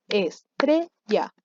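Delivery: slow "Estrella", split es-tre-lla; syllable by syllable. Shown as a demonstration. Teacher counting syllables for eighth graders, "Estrella" syllable by syllable. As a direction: count out "Estrella" in three separate beats. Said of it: "Contemos las sílabas de estrella".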